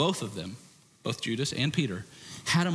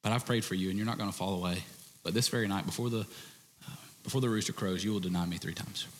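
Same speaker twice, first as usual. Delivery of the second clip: softer, lower, tender warning; gentle warning; 6 semitones lower, 6 dB quieter, faster than usual. Retold or imitated. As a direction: tell him sadly but kindly. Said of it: "Jesus goes".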